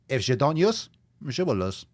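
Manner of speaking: playful mock German accent, rising on "use". Host repeating his fitness motto in mock German accent.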